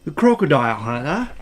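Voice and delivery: put-on low, mild Australian accent; lightly put-on Australian crocodile hunter. Trying an Australian one on request, low Australian accent. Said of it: "Now do an Australian one".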